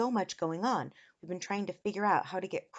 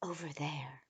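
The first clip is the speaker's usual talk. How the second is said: lizard rider; 3 semitones lower, 7 dB quieter than usual. A narrator's reading. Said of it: "cried the lizard rider".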